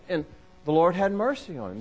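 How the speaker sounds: ordinary delivery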